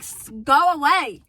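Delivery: slow, loud firm go away; mock stern dismissal; aggressive brush-off. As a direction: shut him down hard. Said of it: "really aggressively be like".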